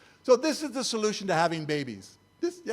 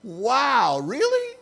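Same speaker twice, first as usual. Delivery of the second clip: scoffing surprised really; incredulous wow; 4 semitones higher, 5 dB louder, much slower than usual. Retold or imitated. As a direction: voice leaps high on really, mock disbelief. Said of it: "I'm going".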